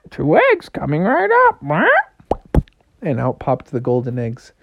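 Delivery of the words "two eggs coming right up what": high squawking bird; chirpy high squawk taking an order; parrot-like goose. Acted. Goose about to lay golden eggs, squawking. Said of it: "Why do you talk like a parrot?".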